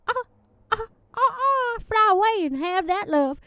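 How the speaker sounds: hammed-up sob breaking high through the words; fake-weep while setting love free; mock crying. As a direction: pitch cracks up into a melodramatic wail. Mock sob over letting her go.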